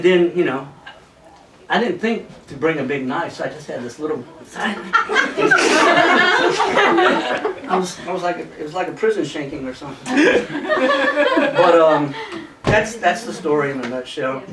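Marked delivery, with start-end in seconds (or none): 4.3-7.6 drawn-out high laughter; long high laugh. Real, not acted. Laughing at own story. 10.2-11.5 short high burst of laughter. Real, not acted. Laughing at own story.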